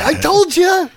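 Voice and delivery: high-pitched I-told-you-so; imitated vindicated outburst; mock triumphant. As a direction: crow it in a high, acted voice. Acts out gleeful reply.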